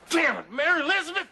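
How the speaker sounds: high, angry yell after her; cursing call, pitch jumping up; exasperated shout. Acted. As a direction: yell out in frustration. Shouting after wife driving off.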